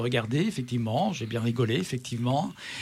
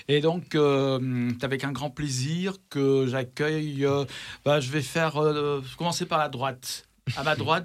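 ordinary delivery

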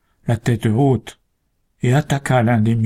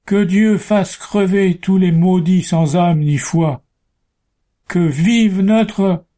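high, loud, slow cry; slow shouted curse; 8 semitones higher, 3 dB louder, slower than usual. A narrator's reading. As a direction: drag out a dying curse. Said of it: "le bûcheron hurlait".

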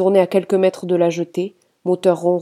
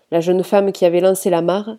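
usual speaking voice

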